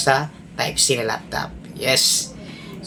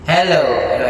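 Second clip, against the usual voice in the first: full volume echo. Shown as a demonstration. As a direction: let the echo ring on each hello. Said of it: "i-full volume natin yung echo".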